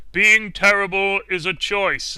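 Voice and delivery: loud, slow, lecturing pronouncement; slow loud mock-stern lecture; stern teammate. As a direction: deliver it like a smug verdict. Teammate lecturing over voice chat.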